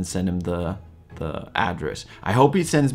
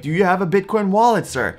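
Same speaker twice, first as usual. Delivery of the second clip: lightly mocked scammer pitch, higher at the start; imitating scammer; 9 semitones higher than usual. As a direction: put on an eager scammer's question. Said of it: "they're gonna be like".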